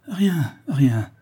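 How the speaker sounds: high, flustered repeated denial; stammering. A narrator's reading. Daughter hides the truth from her father, flustered stammer. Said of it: "répondit Louise en balbutiant".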